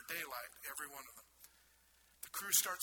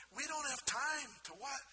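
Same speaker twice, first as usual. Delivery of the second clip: frantic protest; 9 semitones higher than usual. Acted. Crew's frantic objection acted.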